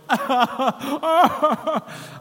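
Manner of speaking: high, loud put-on wail; mock wailing laugh. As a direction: wail it out as if weeping at work. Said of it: "So I could be sitting there going".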